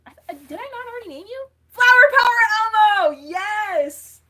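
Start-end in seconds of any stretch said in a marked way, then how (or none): 1.7-4.2 yelled out big and high; thrilled shout; excited shout. Real, not acted. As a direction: belt the name out.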